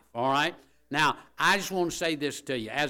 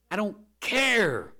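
louder, slower I don't care, pitched high; emphatic; 9 semitones higher, 7 dB louder, slower than usual. Acted. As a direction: hammer the words home. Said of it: "repeat, louder".

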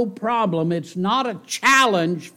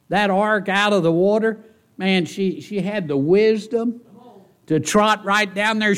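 ordinary talk, nothing marked

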